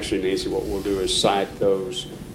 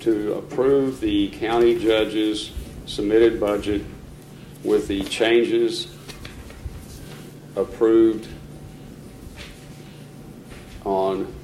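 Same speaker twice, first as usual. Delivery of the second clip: dictating. Acted. Reading motion slowly for record.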